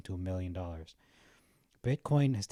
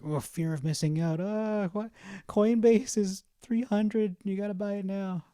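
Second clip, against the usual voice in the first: much higher, panicky inner voice that slows down on Coinbase; mock FOMO panic; 10 semitones higher, 6 dB louder, slower than usual. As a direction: ham up the fear of missing out. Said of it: "my emotional, like".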